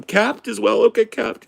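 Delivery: high, loud mock-tearful waitress; whining and crying. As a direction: take the order through hammed-up sobs. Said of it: "she's whining and crying to every customer".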